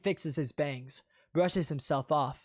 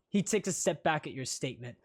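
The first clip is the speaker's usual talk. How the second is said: ordinary delivery, nothing marked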